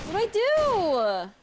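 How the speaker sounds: loud, high and slow; exasperated cry. Real, not acted. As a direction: plead with the game helplessly. Stuck in game, cannot leave.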